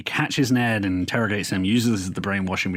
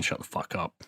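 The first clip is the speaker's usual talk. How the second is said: dismissive mutter; 3 semitones lower, 8 dB quieter than usual. Retold or imitated. Roderick telling his brother to chill.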